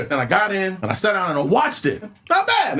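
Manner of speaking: ordinary talk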